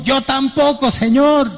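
high, amused retort; lightly voiced friend joking back; a hint of the friend's high cheeky reply; friend's line. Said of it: "Y yo he dicho".

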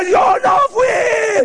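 high, drawn-out hammed-up scream; wailed denial. Child whipped by mom, high wailing protest. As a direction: imitate a kid howling innocence under the blows.

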